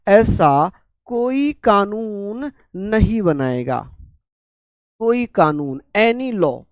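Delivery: very slow, words drawn out with long pauses; slow dictation. Real, not acted. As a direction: dictate phrase by phrase for note-takers. Teacher dictating article for students writing.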